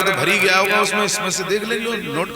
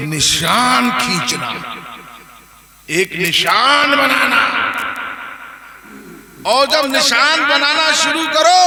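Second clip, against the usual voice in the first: slow, high, loud; slow rising preaching emphasis; emphatic instruction; 7 semitones higher, 4 dB louder, slower than usual. Acted. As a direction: drive each word of the instruction home.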